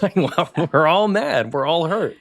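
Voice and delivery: half-mocking a hurt kid; whiny kid voice. Kids voiced as confused siblings.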